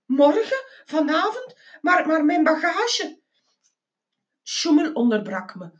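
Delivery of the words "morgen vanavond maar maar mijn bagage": high, rising startled questions; sputtering. A narrator's reading. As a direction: stammer a protest in rising questions. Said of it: "Ik sputterde". (hoog, verbouwereerd sputteren)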